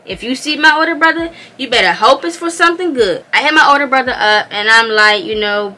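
ordinary talk, nothing marked

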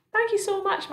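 high, courteous thanks; grateful grasshopper. A narrator's reading. Grasshopper gratefully thanks Miss Spider.

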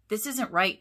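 ordinary delivery

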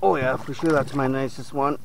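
ordinary talk

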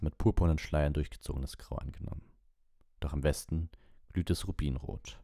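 ordinary delivery, nothing marked

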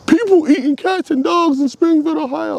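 hammed-up mock of the speech; Donald Trump impression. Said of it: "Donald Trump on the fucking T V talking about".

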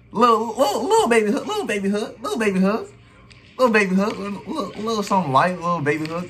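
high, sing-song taunting of a weak shot; mocking taunt. Reacting to his own game footage, taunting a defender's little hook shot, mock sing-song.

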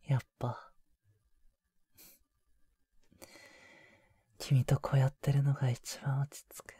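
soft, hushed sigh of relief; relieved whisper. Acted. Boyfriend hugging his girlfriend after finally meeting, relieved whisper. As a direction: whisper it into her ear while holding her.

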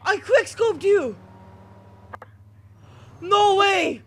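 high, loud, slow brag; triumphant shout. Real, not acted. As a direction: scream your victory. First successful quickscope.

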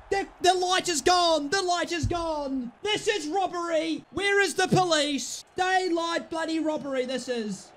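aggrieved high-pitched moaning; wailing protest. Real, not acted. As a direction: wail that you've been robbed. Lamenting the goal as robbery.

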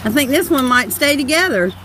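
ordinary talk, nothing marked

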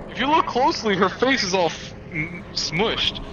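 high mocking voice. Mocking character's face.

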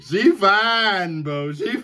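high, stretched-out Southern admiration; Southern boy voice. Shown as a demonstration. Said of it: "say it in my Southern boy voice".

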